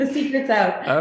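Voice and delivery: laughing through the words; light laughing delivery. Real, not acted. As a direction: chuckle it out as a joke. Joking about the name's secret.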